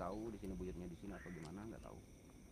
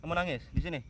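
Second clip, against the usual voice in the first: higher and louder, demanding; loud questioning; 7 semitones higher, 10 dB louder than usual. Real, not acted. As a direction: call out to the spirit to answer. Questioning a spirit at a tree. (bertanya lantang dan tinggi)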